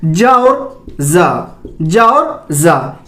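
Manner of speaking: first "जा" jaa, second zaa; jaa, then low zaa, drawn out; J versus Z contrast. Shown as a demonstration. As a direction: slowly contrast "जा" with j and "जा" with z. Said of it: "दोनों में अंतर है".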